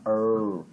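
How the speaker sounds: "er" with the tongue pulled far back, caipira-style; curled-back English R. Shown as a demonstration. Teacher showing how every English R sounds, caipira R. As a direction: hold a retroflex R on "er". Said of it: "todo R ... você vai falar".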